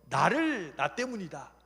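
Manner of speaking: acted forceful admission; bold confession. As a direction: proclaim your guilt to everyone. Jonah declares himself to the sailors.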